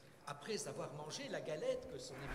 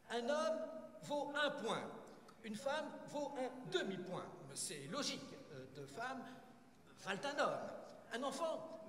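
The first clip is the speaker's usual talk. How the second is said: raised, emphatic official briefing; stern census chief; 5 semitones higher than usual. Acted. Census chief briefing his new recruits on the rules, stern. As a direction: lay down the rules in a higher, firm voice.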